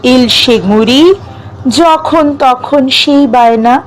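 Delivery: measured verse reading with long pauses between lines; poem recitation. Acted. Reciting a self-written poem about dreams to listeners, measured poetic delivery. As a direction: recite it phrase by phrase, lifting some line endings, letting others fall.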